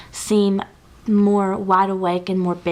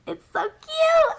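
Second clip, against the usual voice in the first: high, slow squeal of delight; squealing at a cute palette; slower than usual. Real, not acted. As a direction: squeal like you just saw a puppy. Gushing over eyeshadow palette.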